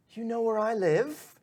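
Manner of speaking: high, slow wondering question; surprised Chet. Acted. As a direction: ask it amazed. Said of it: "he's like".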